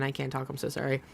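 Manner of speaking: usual speaking voice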